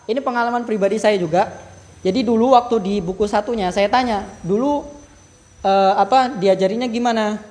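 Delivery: usual speaking voice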